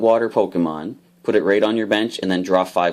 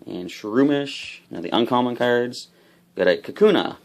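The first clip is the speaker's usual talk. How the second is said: usual speaking voice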